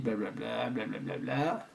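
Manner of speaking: low, very soft blah-blah filler; skimming mumble. Pretend. Skimming the certificate for viewers, soft low mumble. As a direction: trail through the text under your breath.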